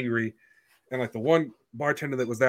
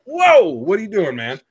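high, loud startled "whoa"; loud, high-pitched disbelief; 9 semitones higher, 9 dB louder than usual. Retold or imitated. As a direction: recoil in alarm, pitch jumping up. Acted startled reply to swinging drunk.

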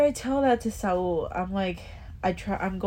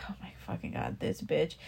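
annoyed whisper; 3 semitones lower, 8 dB quieter than usual. Retold or imitated. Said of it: "I'm like".

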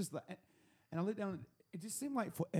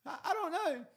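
high-pitched stammered holler; yelling through door; 13 semitones higher, 3 dB louder than usual. Retold or imitated. Paranoid host answering through the door, high stammered yell. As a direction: call back high, tripping on the first word. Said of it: "yelling through the door".

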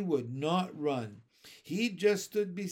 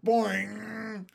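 high, drawn-out boing; muffled yell; 6 semitones higher, 4 dB louder than usual. A narrator's reading. Masked child tweaking another curl, muffled yelling. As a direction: sing out a springy, muffled yell. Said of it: "yelled the muffled".

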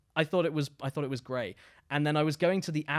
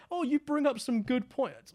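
mock reviewer; 10 semitones higher than usual. Mocking reviewer voice.

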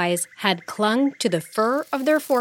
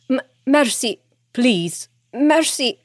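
higher, stammering plea; begging for mercy; 5 semitones higher than usual. Acted. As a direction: beg for your life. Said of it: "he begged".

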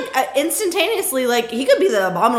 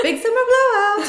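shopkeeper impression; 14 semitones higher, 6 dB louder than usual. Quoting Frozen shopkeeper line.

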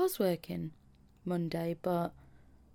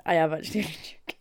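laughing; 2 semitones lower, much faster than usual. Real, not acted. Laughs off the joke.

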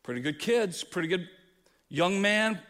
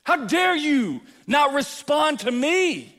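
loud, high, slow indignation; outraged entitlement; 10 semitones higher, 9 dB louder, slower than usual. Retold or imitated. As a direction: take offence at God. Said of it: "we go".

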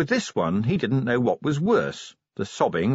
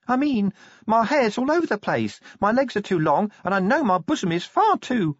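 put-on higher, fretful girlish voice; Tawny, fretting; 10 semitones higher than usual. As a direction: list worries breathlessly. Tawny frets about looks.

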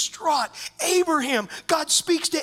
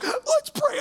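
wife's voice, much higher; a high, sing-song imitation of his wife; playful high mimic of his wife; mock wife voice. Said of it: "my wife is like".

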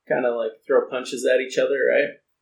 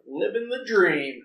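higher, slow mock contentment; sarcastic; 6 semitones higher, slower than usual. Imitating people answering how they are, sarcastic. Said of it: "people being sarcastic".

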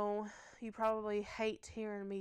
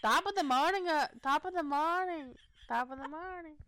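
high, fumbling Irish lilt, fading from loud; amateur Irish accent, pitched up; Irish accent attempt; 5 semitones higher, 5 dB louder than usual. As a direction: keep retrying a jokey Irish greeting. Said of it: "that's my Irish accent".

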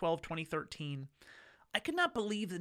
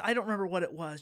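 ordinary talk, nothing marked

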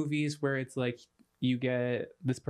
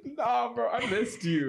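laughing; 8 semitones higher than usual. Real, not acted. Laughing while saying it.

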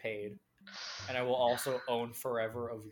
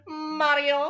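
high loud Luigi yell; Luigi screaming; 8 dB louder than usual. Retold or imitated. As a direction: scream for his brother like Luigi. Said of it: "it just has him go".

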